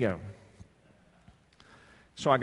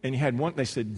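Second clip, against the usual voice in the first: ordinary talk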